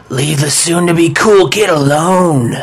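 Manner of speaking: coarse, gnarly voice from under the ground; menacing ghostly bellow, hammed up in the retelling; gravelly ghost scream. Boy voices a ghost warning off a bully, gravelly scream. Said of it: "coarse, gnarly, gravelly voice comes up from under the ground and screams".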